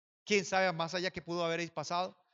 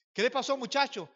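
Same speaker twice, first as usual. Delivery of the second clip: retold alarmed question; 6 semitones higher than usual. Neighbours' startled question acted out.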